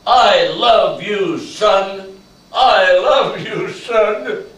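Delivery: father calls out to his son, loud and slow; loud and clear. Retold or imitated. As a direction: call it out across the wall. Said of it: "say it loud and clear".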